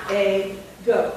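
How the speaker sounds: "A, go" with a full long "A"; precise syllables. Shown as a demonstration. Teacher says syllables as she writes, precisely separated. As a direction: say "A" and "go" as two clear syllables. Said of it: "syllables precisely".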